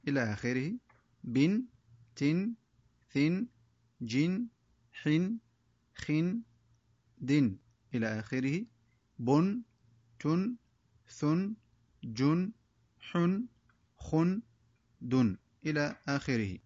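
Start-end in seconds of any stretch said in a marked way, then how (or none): 1.2-6.4 each syllable said alone with a clear nun ending, "بن، تن، ثن"; tanween drill read aloud. Shown as a demonstration. Teacher reading the tanween chart aloud for pupils, drilled syllables. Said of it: "وطريقة قراءة هذا الدرس هكذا". 9.2-14.4 each syllable said alone with a clear nun ending, "بن، تن، ثن"; tanween drill read aloud. Shown as a demonstration. Teacher reading the tanween chart aloud for pupils, drilled syllables. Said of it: "وطريقة قراءة هذا الدرس هكذا".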